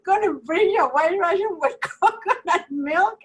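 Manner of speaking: high, incredulous question through laughter; laughing. Retold or imitated. Retelling teasing Carolina about her drink order, laughing. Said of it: "I start laughing and I go".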